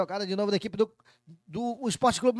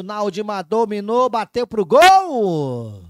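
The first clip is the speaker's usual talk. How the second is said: excited shot call; 10 dB louder, slower than usual. Real, not acted. Shot on goal.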